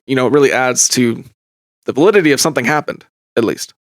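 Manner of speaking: ordinary talk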